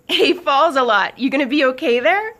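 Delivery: loud, high-pitched teasing; amused teasing. Acted. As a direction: call it out mockingly, way up high. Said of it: "laughing at his expression of relief".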